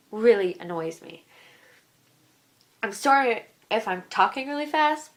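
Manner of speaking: usual speaking voice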